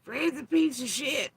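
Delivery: high sneering impression of a rival; mocking quote. Mocking Six Up calling out Fred, high sneer. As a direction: sneer it high like a snide gossip.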